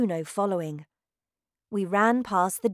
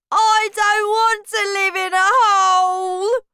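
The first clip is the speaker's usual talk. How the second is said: shouted; 13 semitones higher, 11 dB louder than usual. Acted. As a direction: shout in disgust at the idea. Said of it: "Bruno shouted".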